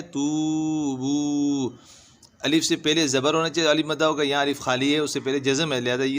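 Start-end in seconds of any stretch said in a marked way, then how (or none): 0.0-1.7 तूबू slowly, each oo held long, falling at the end; both vowels drawn out. Shown as a demonstration. Teacher repeating the two madds, तूबू stretched long. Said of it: "दोनों में वाव मद्दा है".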